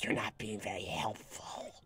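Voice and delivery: whispered put-on grumble; hushed, airy mock reproach; mock-complaining whisper. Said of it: "So it's just like".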